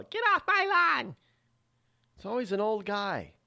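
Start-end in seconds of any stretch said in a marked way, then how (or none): 0.0-1.2 put-on quick, high, strained old-man yelp; mock old man yell. Imagined old woman yelling at lawn trespassers, high mock yell. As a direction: toss off a cranky geezer's shout. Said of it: "Never heard a woman yell".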